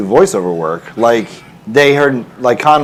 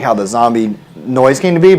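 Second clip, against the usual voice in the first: ordinary talk, nothing marked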